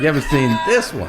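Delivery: high, fast, gushing imitation; mock eagerness. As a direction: push it out eagerly, mimicking an enthusiast. Mimics eager woman showing photo albums.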